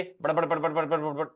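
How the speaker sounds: rapid-fire babble of syllables; too fast. Shown as a demonstration. Teacher showing students the wrong fast speed, rapid babble. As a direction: rattle off bad bad bad at speed. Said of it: "ऐसे fast नहीं बोलना है".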